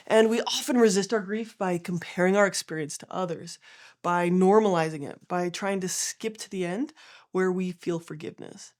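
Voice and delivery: ordinary delivery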